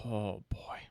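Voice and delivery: awed whisper after a wild tweet. Real, not acted. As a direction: whisper it under your breath in disbelief. Reacting to the [name] tweet.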